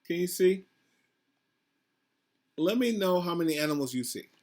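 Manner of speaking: plain reading voice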